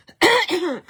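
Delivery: sharp, loud throat-clearing coughs; real coughing fit. Real, not acted. Crafter coughing mid-demo with a frog in her throat, loud coughs. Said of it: "Excusez-moi".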